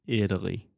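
"Italy" with the T turned to a D. Shown as a demonstration. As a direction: say "Italy" like "Idaly". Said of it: "Think about this as a D sound".

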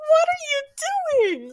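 high slow laughing question. Retold or imitated. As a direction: ask it while cracking up. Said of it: "they're on the floor laughing".

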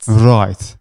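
learner echoing "Right" in South African; careful South African imitation; attempted South African accent. Shown as a demonstration. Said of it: "in, in South African".

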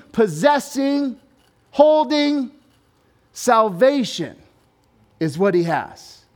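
loud, high proclamation with ringing, drawn-out key words; full-force preaching. Real, not acted. Preacher proclaims the king has come, shouting it with full force. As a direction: preach it out to the whole room.

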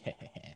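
low, soft, smug heh-heh; gloating chuckle. Acted. Alyssa sees his leaving as victory, gloating chuckle. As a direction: chuckle under the breath in victory. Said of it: "She's just like".

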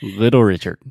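"[name]." said loudly to show the sound; loud demo. Shown as a demonstration. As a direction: call out "[name]." for the pronunciation. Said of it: "that's a good way to think about it".

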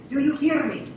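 put-on high, loud Dutch-accented call to the class; a Dutch professor booming a check-in question. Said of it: "he'd say it with this thick Dutch accent".

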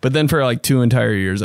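normal talking voice

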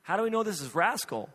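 doubting wife. Retold or imitated. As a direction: raise the pitch as you question it. Wife questions the cat's identity.

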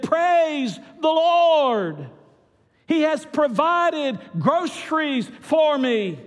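high, loud, drawn-out thanksgiving; jubilant praise shout. Acted. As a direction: proclaim it slowly to the skies. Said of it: "she lifted up her arms, and she said".

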